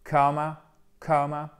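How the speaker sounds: "Kama" as KOH-ma, gliding vowel; diphthong O. Shown as a demonstration. Teacher names the coma word, O diphthong. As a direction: round the first vowel of "kama" into O. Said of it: "c'est le coma".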